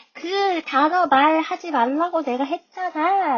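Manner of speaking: high, slow mock yell at a classmate. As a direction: scold her loudly, half-acted. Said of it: "소리를 꽥 지르죠". (반쯤 연기한 꽥 소리)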